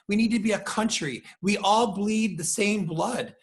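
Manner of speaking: ordinary talk, nothing marked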